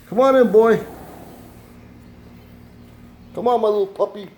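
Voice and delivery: very high, loud sing-song call to the dog; high pet talk. Real, not acted. Owner calls his dog back in with a loud, very high coo.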